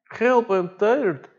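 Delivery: high, slow, drawn-out whimper; whiny tired plea. Retold or imitated. A tired diver whining to the boat crew, high and slow. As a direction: stretch it out like an exhausted man pleading. Imitating Darius in the water.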